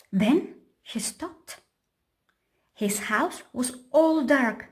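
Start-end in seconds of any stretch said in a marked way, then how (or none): none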